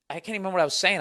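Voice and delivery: ordinary delivery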